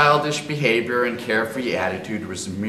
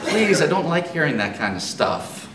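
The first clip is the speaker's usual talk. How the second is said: laughing; 8 semitones higher, 4 dB louder than usual. Acted. Disgusted teasing through laughter.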